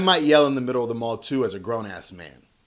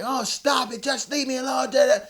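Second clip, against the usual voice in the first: imitated yell; 10 semitones higher than usual. Dad imitating his middle son yelling at his brother, mock yell. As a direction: imitate a bothered kid yelling, much higher. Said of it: "he was just yelling".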